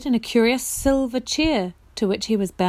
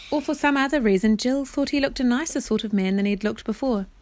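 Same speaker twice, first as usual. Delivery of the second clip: usual speaking voice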